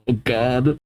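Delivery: ordinary delivery, nothing marked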